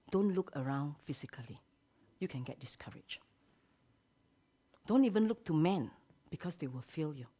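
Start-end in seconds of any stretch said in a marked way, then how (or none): 0.1-3.2 soft, whispered caution; whispered warning. Real, not acted. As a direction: draw them close and whisper. Preacher drops to whisper.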